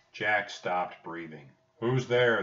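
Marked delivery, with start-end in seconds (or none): 1.8-2.4 loud, raised-pitch challenge; shouted challenge. A narrator's reading. Captain to whoever hides by the rock, shouted challenge. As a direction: call out sharply into the dark. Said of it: "the captain shouted".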